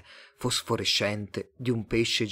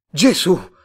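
loud, high cry of shock; startled outburst; shocked exclamation; 8 semitones higher, 12 dB louder than usual. A narrator's reading. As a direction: blurt it out loudly, startled. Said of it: "esclamò Tomás".

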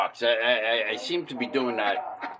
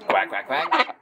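quack, quack, quack, pitched up like a duck; higher duck quack; 5 semitones higher than usual. Retold or imitated. Owner quacking at his duck Tweety, higher pitched. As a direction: quack back at the duck in a raised, playful voice.

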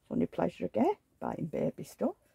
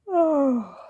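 surprised cry; 7 semitones higher, 7 dB louder than usual. Real, not acted. Gasp at doll's legs.

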